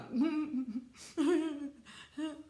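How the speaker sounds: high, uncontrollable mock blubber; messy high crying noises, played up; demonstrated blubbering. Shown as a demonstration. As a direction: fake an ugly blubbering cry. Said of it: "Blubbering is just uncontrollable".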